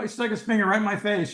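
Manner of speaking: ordinary delivery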